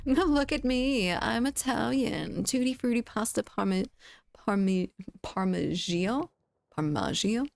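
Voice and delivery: badly faked Italian voice; fake Italian accent. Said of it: "I can't even fake Italian".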